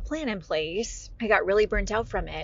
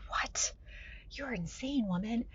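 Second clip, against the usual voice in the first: stunned half-whisper; 4 semitones higher, 9 dB quieter than usual. Retold or imitated. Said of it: "I'm like".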